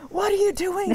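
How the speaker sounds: exasperated high-pitched coach yelling at players; mock a baffled coach losing patience; frustrated coach shouting across the pitch at beginners. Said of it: "he would always be so frustrated that he'd go".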